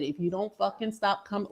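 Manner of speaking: hushed warning to a pushy neighbor; quiet threat. Retold or imitated. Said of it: "I quietly came up to her ear".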